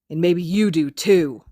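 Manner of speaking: slow, venomous threat. Acted. Kacchan glaring daggers at Izuku, slow venomous threat. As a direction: let the threat drip out slowly. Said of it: "adds venomously".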